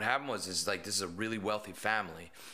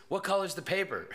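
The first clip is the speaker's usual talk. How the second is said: mock kid; 10 semitones higher, 3 dB louder than usual. Kid testing through door.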